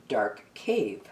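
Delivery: ordinary delivery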